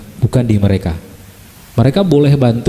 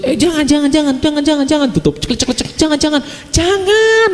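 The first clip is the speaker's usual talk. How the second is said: much higher, squealing protests; shy wife squealing; 11 semitones higher than usual. Retold or imitated. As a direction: squeal in mock embarrassment. Wife shrieking as husband joins bath. (istri menjerit malu, suara jauh lebih tinggi)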